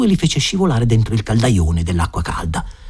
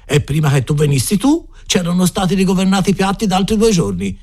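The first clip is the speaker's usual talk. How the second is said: animated, higher-pitched cook's explanation; cook holding forth; 8 semitones higher than usual. A narrator's reading. Cook explains the Friday soup recipe to his helper, holding forth. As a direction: expound it with relish, pitch riding high.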